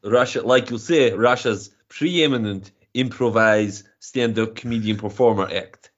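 hammed-up Russian accent; broad comic Russian accent; mock Russian accent. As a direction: play it as a proud Russian comedian in a thick Russian accent. Comic Russian guest persona.

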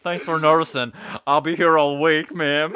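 loud stand-up comic shtick; brash vaudeville comic. Pretend. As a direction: belt it out like a stage comic. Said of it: "I'm a comedian".

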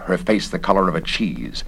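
usual speaking voice

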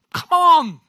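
loud, high exasperated cry; exasperated urging. Real, not acted. Preacher urging listeners to have a go, exasperated urging. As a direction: shout it in disbelief, dropping at the end. Urging the congregation against a ridiculous idea.

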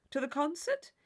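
a hint of the simpering partner; mock polite inquiry. A narrator's reading. Tilney quizzing Catherine in mock formality.

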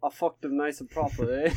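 put-on British voice; a jokey, cheeky British accent; mock British accent. Joking as the British guy who came with the bride, mock British accent.

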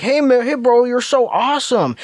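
high, gushing fake flattery; butter them up like a scammer; mock flattering scammer. Mocking the scammer sweet-talking the gullible parents, high gush. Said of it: "you tell them, like".

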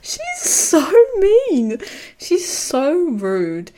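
high, slow, whining mimicry of the girl; whiny mock voice. Friend mocking a character's sulk, whining high and slow. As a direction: whine it like a sulky teen, drawn out.

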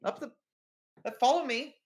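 high, loud, flustered hurry-along voice; hurried receptionist. Acted. Receptionist hurrying visitors upstairs, high flustered voice. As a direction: usher them along in a bright rush. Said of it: "it's like".

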